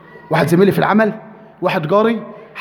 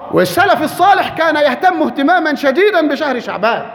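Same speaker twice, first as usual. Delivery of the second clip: normal talking voice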